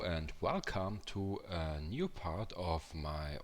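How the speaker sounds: weak, worn-out sick voice; sounding like dying. Real, not acted. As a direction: croak it out like you're ill. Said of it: "oh, I sound like I'm dying".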